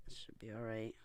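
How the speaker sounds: unvoiced whisper to herself. Real, not acted. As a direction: breathe it out, barely audible. Whispered aside.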